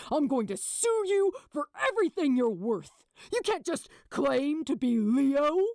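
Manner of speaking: loud, high furious rant; angry tirade. A narrator's reading. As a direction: keep snarling at him. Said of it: "Gabriel snarled at him".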